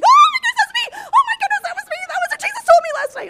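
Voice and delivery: very high, loud, laughing shouts; screaming with joy. Retold or imitated. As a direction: scream it out in sheer delight. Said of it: "like, screaming".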